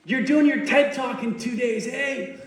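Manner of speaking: high-pitched mock yell across a noisy room; hammed-up shouting to a friend; imitated yelling. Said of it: "So he's yelling".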